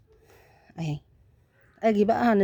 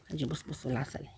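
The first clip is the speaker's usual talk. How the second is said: much softer and lower, near a whisper; hushed; 5 semitones lower, 12 dB quieter than usual. Real, not acted. Showing viewers the cat, in a hushed voice. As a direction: hush so you don't wake the kittens.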